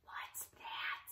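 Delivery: whispered suspense. Acted. Teacher whispering to the children as the whale appears. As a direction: breathe it out, something's coming.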